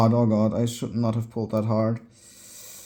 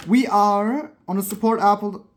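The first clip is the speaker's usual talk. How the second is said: excited; 7 semitones higher, 4 dB louder than usual. Real, not acted. Screen survived, elated.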